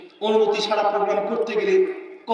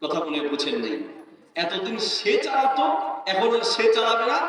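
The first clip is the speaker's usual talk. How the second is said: normal talking voice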